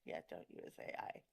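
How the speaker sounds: soft whispered mock echo. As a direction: whisper it back jokingly.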